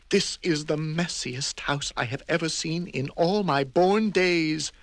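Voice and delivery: scandalised; fussy indignant voice; prim outrage. Acted. Both men exclaim.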